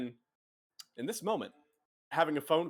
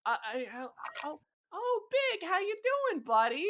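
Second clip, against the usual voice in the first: much higher, stammering greeting; flustered squeak. Acted. A nervous woman stammering a greeting to an unwanted caller, high. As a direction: squeak out the greeting, caught off guard.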